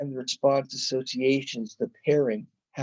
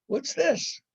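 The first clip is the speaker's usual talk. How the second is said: puzzled question; 12 semitones higher than usual. Retold or imitated. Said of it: "And he says".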